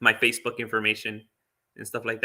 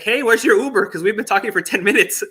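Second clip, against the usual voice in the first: sudden high, louder question; surprised; 8 semitones higher, 5 dB louder than usual. Retold or imitated. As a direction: blurt it out in surprise. Retold man suddenly noticing the time.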